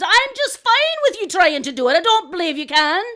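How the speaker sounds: high, loud, lilting challenge; doubting challenge. Acted. Crow goading the boaster, challenging. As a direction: goad him with open doubt.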